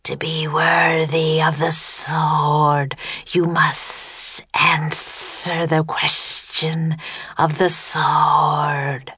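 very slow, stretched-out monster voice; ancient serpent voice. A narrator's reading. Serpent setting the children a test, slow ancient voice. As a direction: draw out the words, lingering on each S. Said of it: "the ancient voice boomed".

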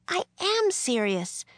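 put-on high-pitched indignant girl's protest; voice rising. A narrator's reading. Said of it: "her voice rising".

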